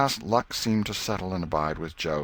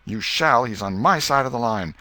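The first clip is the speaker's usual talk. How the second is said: higher, louder schoolboy insistence; angry Joe; 5 semitones higher, 6 dB louder than usual. A narrator's reading. Joe to Tom, claiming the tick, angry and louder. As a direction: plant your feet and claim the line. Boys quarrel over tick.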